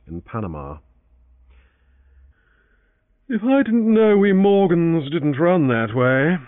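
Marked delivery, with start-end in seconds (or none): 3.3-6.4 slow, loud yawned words; yawning. Acted. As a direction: say it through a yawn. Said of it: "he yawned".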